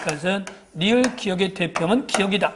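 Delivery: normal talking voice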